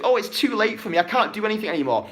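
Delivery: high whiny mock lament, fast at the end; mocking victim voice. Coach mocking a wallowing viewer, whiny. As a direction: parody the moaning victim. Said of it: "you're playing the victim".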